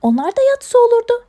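high, loud incredulity; exasperated scolding. Acted. Owner questions putting hedgehog to bed, loud exasperation. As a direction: wonder aloud, exasperated and high-pitched. Leyla exasperated at animals' bedtime idea.

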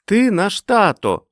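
put-on high, slow, loud childish cry; drawn-out high child shout; children's chorus exclamation. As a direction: exclaim it like kids in unison. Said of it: "вигукнули вони хором".

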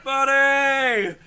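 loud, held call back; imitated group reply. Kids in the pool answering the counselor, imitated shout. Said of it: "They were like".

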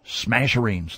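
plain reading voice

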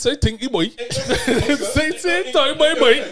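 high, loud Bajan accent through laughter; Bajan accent demo. Shown as a demonstration. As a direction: show off a playful, hammed-up Bajan accent. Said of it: "Go on, Bayesian boy".

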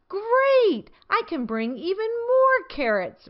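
high, eager, with a smile; bursting with happy greed; smiling delight. A narrator's reading. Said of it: "Rabbit said with a smile".

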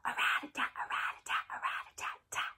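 soft whispered drum roll; whispered snare drum. Shown as a demonstration. Teacher to kids, whispering a snare drum. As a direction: rattle it out in a whisper. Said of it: "Can you try to do that with me".

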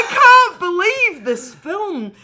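can hardly get it out for laughing; shocked laughing rant; laughing disbelief. Retold or imitated. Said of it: "And I'm like".

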